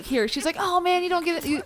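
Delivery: ordinary talk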